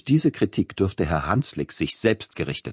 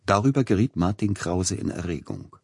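ordinary delivery

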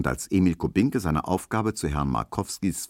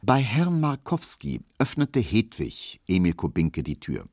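ordinary delivery

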